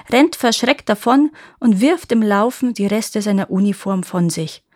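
plain reading voice

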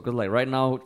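normal talking voice

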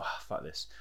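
breathy under-the-breath mutter; quiet mock exasperation; muttered aside. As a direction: throw it away under your breath, unvoiced. Muttered aside while quoting.

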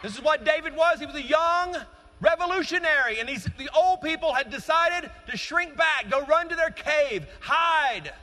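high, loud, driving sermon delivery; full-force preaching. Acted. Pastor rousing the young congregation, full-force preaching. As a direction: preach it out to the whole church.

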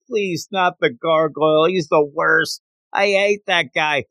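high-pitched, hammed-up begging; pleading. Underling begging not to face the gargoyle, lightly acted pleading. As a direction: plead to be let off a dreaded errand.

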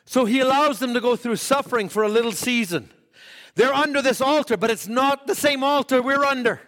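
high-pitched preacher's proclamation. Acted. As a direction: preach it with pitch held high, short of a shout. Preaching, easing down.

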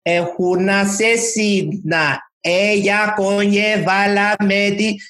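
very slow, high, stretched Spanish line. Shown as a demonstration. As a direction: drag each syllable out like slowed audio. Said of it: "digital technology slow it down".

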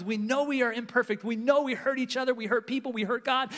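normal talking voice

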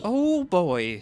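panicked. A narrator's reading. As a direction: brace for impact, voice jumping up. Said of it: "Doctor Mintz spins around in panic".

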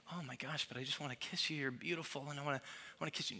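soft, quick gushing plea; a dad softly pleading for a kiss; doting dad. Retold or imitated.

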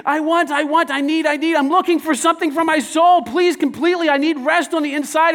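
high, loud pleading I want, I need; needy whining soul. Retold or imitated. Preacher mocking the congregation's needy cravings, high and loud. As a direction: act out a desperate soul begging for fulfilment.

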